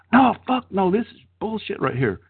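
mock angry outburst. Retelling his date's angry shrimp complaint at the restaurant, mock outburst. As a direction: imitate her blowing up over the shrimp.

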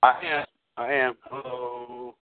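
ordinary delivery

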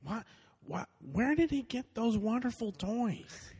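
high drawn-out Owen Wilson; slow, wondering, high surfer drawl. Pretend. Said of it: "what would that sound like".

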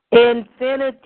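loud, ringing cry of infinity; passionate yell. Acted. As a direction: shout it out in passion. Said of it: "I yelled out passionately".

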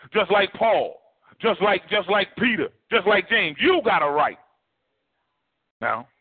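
rising, rhythmic preacher's call; preaching. Acted. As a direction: build the list of names like a sermon. Preaching to the congregation.

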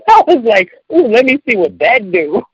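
laughing loudly through the words; laughing out loud, delighted. Real, not acted. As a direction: talk while laughing hard.

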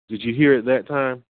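usual speaking voice

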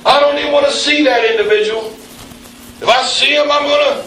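a hint of a fuming churchgoer; mock grudge. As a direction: play the offended one seething. Acting out a grudge.

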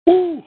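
a sharp, high, loud woo to blow off the run; high breathless whoop. Real, not acted. Worn out streamer lets out a high, loud whoop. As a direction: whoop out the exhaustion. Winded after running, whooping exhale.

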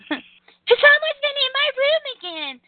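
very high-pitched, exaggerated whine; mock whining. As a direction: whine it high, dragging out the last word. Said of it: "all she did was bitch and whine".

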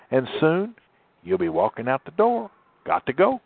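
put-on sing-song old storybook guide nudging you out the door; Father Time. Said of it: "what Father Time said in the movie".

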